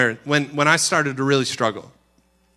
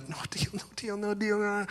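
hushed, higher-pitched rapid repetition; frantic refusal under the breath; anxious inner refusal; 5 semitones higher, 11 dB quieter than usual. Retold or imitated. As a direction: refuse softly, over and over.

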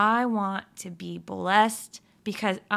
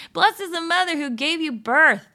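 loud, higher praise hollered out; hollering; 6 semitones higher, 5 dB louder than usual. Retold or imitated. A woman in the crowd hollers praise to Jesus, loud. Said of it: "He's hollering out".

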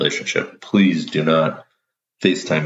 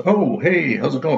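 much higher, mock chipper dad; dad answering; 10 semitones higher than usual. Dad picking up his son's shower FaceTime, high and chipper. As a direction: lift it way up like a startled, cheery dad. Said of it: "He goes".

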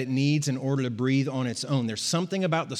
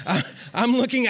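failed British accent attempt; 8 semitones higher, 3 dB louder than usual. Shown as a demonstration. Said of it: "I can't do it".

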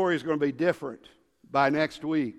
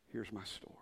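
whisper; 6 semitones lower, 18 dB quieter, faster than usual. Acted. Solemn hush before God.

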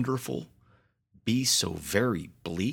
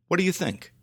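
higher, eager host question; 9 semitones higher, much faster than usual. A narrator's reading. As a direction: ask it brightly eager. Cedric asks the kids.

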